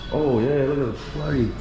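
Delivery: usual speaking voice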